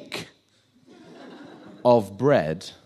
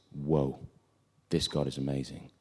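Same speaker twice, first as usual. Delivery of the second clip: low, soft amazement; hushed awe; 9 semitones lower, 11 dB quieter than usual. Acted. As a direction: murmur it as a stunned onlooker. Onlookers amazed by the fire.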